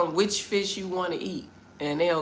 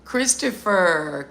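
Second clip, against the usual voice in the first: normal talking voice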